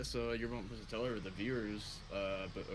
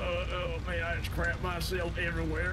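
loud, higher whiny complaint; loud groaning complaint; 6 semitones higher, 7 dB louder than usual. Acted. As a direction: moan loudly about the mess. Injured driver after crash.

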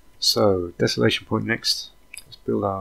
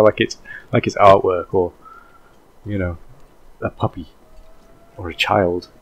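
normal talking voice

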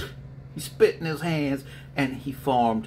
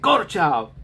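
barked dismissal; 8 semitones higher, 7 dB louder than usual. Retold or imitated. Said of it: "He said".

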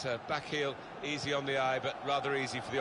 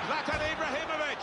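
player's name called high; sustained high pitch on the striker's name; excited name call; 11 semitones higher than usual. Acted. Commentator as Zlatan nears goal, excited.

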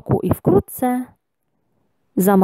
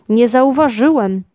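higher, mildly embarrassed; flustered excuse; 5 semitones higher than usual. A narrator's reading. Lucjan explains himself to the gnome, mildly flustered. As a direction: excuse yourself with a touch of confusion. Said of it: "powiedział zmieszany Lucjan".

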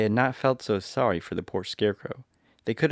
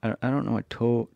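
ordinary delivery, nothing marked

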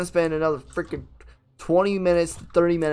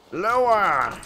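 high, louder mock holler; sailor calling orders; 5 semitones higher, 5 dB louder than usual.

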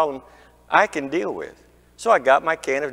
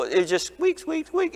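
squeak, squeak jumping high and softer; squeaky car noise; 4 dB quieter than usual. Retold or imitated. Pastor mimicking the squeak that mechanics could not fix, high squeaky voice.

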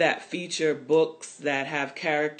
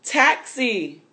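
calling out, higher and louder; shout for a taxi; 8 semitones higher, 6 dB louder than usual. Acted. Mama hailing a taxi in the market, calling out loud. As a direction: hail the taxi with a sharp call. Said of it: "Mama shouts".

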